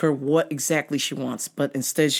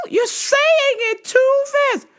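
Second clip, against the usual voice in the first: put-on very high, loud and slow old lady; old lady caller; 5 dB louder, slower than usual. Old lady caller demanding on phone, very high and slow. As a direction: quaver it out like a frail old lady.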